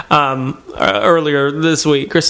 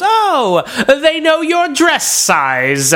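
high, slow mocking jab; lightly voiced old curmudgeon; grumpy old man; 9 semitones higher, much slower than usual. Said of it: "and he goes".

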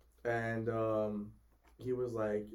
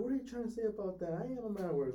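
high-pitched mock protest; indignant customer; 8 semitones higher, 3 dB quieter than usual. Customer answering an accusation, mock indignant. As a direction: imitate a man defending his honor.

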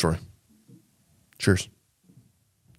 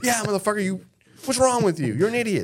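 high, louder hammed-up scolding; mock angry dad; 12 semitones higher, 4 dB louder than usual. Dad yelling at passed-out son, hammed-up angry. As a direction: yell like a furious father.

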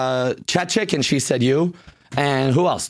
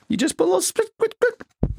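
squeaky voice; 14 semitones higher than usual. Acted. Ad read, mock spitting.

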